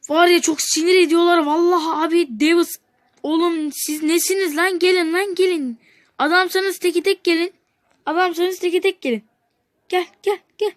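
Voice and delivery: loud, high, furious taunting of the players; angry yelling. Real, not acted. Gamer yelling at rival players in anger. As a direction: bark a challenge at the screen.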